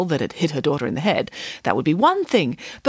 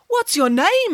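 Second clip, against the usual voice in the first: lightly voiced prince; 13 semitones higher than usual. Prince asking Rapunzel.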